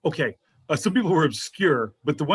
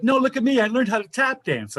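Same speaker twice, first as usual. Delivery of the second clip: mock needy plea; 7 semitones higher than usual. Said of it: "I'm going like".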